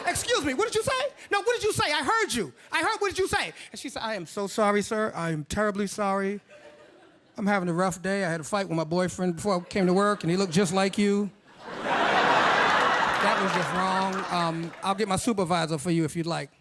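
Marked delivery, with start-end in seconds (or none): 0.1-3.5 high-pitched, offended challenge; affronted, insistent comeback; indignant confrontation. Retold or imitated. Acted confrontation with rude cashier. 4.0-16.5 low, measured apology; deep, over-earnest sorry employee; apologetic cashier. Retold or imitated. Acted cashier apology in put-on voice.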